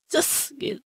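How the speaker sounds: very high, soft squeaky "Ces"; playful mock wince; mock heart-pang squeal. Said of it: "langsung nangis".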